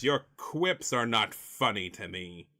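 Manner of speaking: slow, deliberate villain threat; Mister Freeze. Acted. Villain warns the joking heroes, slow and deliberate. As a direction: measure out each word of the demand. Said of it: "and he says".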